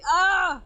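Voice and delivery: short, high, loud "ah!" of surprise; a sudden yelp that cuts the sentence off; startled yelp. Real, not acted. Outburst mid-sentence.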